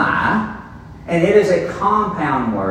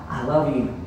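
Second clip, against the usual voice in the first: tender parent; 5 semitones lower, 6 dB quieter than usual. Pretend. Imagined parent telling child.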